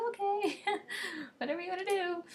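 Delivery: high, laughing brush-off; airy, laughing whatever; dismissive okay. Retold or imitated. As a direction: toss it off with a high giggle. Brushing off his secret love.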